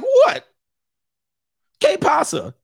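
squeaky high feigned innocence; innocent girlfriend. Retold or imitated. Caught girlfriend playing innocent, high and squeaky. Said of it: "She be like".

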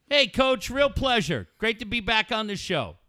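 high-pitched mock interviewee; Elephant Man as radio guest. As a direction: greet the host in a high, polite character voice. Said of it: "Coach talk to Elephant Man".

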